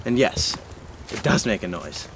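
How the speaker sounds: ordinary talk, nothing marked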